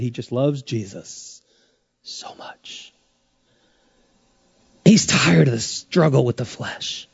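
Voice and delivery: drops to a near whisper; hushed and tender. Real, not acted. Preacher whispering how much Paul loves Jesus, hushed.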